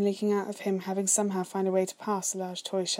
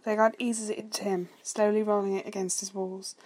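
usual speaking voice

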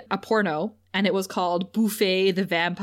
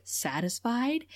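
soft, slow, softer sultry question; suggestive murmur; 6 dB quieter, slower than usual. Retold or imitated. Imitating Spike's suggestive question.

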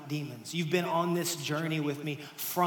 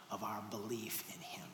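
whisper; 6 semitones lower, 13 dB quieter than usual. Acted. Preacher drops to a whisper.

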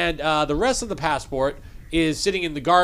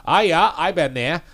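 put-on Maine accent. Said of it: "that does sound different".

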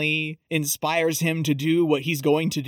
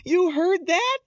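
much higher-pitched startled question; mock surprise; 11 semitones higher than usual. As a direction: imitate her being caught out, voice jumping up. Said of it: "And she's like".

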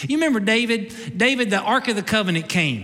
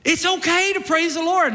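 ordinary talk

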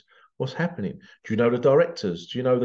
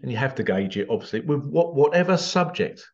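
normal talking voice

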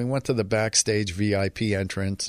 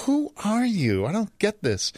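amazed date; 11 semitones higher than usual. Retold or imitated. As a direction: play her bewildered by the passes. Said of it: "and she's like".

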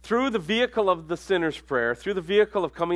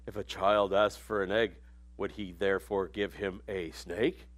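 put-on low, solemn old-movie Jesus, lightly done; stoic movie Jesus; 7 semitones lower, 6 dB quieter than usual. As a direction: intone it like a stiff film Jesus. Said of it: "I don't think Jesus talked like that".

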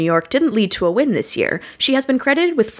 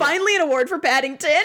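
excited; 9 semitones higher, faster than usual. Real, not acted. Gleeful reaction.

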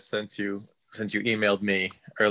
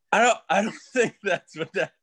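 half-laughing, pitch up; can't keep a straight face while saying it; laughing through words; 9 semitones higher, 4 dB louder than usual. Real, not acted. Calling his teacher, laughing at the name's meaning.

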